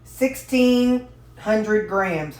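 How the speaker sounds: sixteen hundred said slowly, working it out; thinking aloud, drawn out. Real, not acted. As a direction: say it slowly while you calculate.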